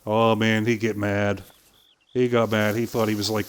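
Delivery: ordinary talk, nothing marked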